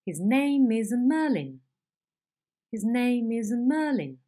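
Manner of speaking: stepped pitch falling down each accent; intonation demo with a stepping fall; stepping head demo. Shown as a demonstration. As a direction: step each accent down, ending in a fall on Merlin. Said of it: "And the example would be".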